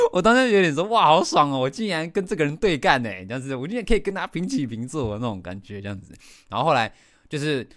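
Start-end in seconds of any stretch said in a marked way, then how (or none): 0.9-6.1 higher, louder triumphant glee; gleeful. Retold or imitated. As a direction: relish the victory. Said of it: "我当下其实好爽哦". (得意兴奋地说)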